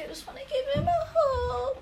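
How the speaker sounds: high, drawn-out cooing; gushing affection. Real, not acted. Student looking at photos of her dog, gushing affection. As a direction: coo it slowly, high, aching to cuddle.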